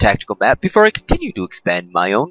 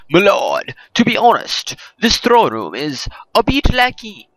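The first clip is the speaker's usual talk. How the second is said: higher, hammed-up French accent for the advisor; mock French advisor; 5 semitones higher than usual. French advisor counselling the king, in a hammed-up French accent. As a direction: play the French advisor with a bad, lifted French accent. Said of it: "a terrible French accent".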